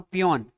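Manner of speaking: पियोन with an open o, "pee-YON"; पियोन, "aw" vowel before the n; taught reading of peon. Shown as a demonstration. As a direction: model पियोन as a single falling word. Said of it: "मैं फिर से बोल रहा हूं".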